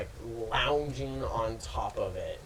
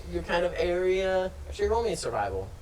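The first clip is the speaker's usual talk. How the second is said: normal talking voice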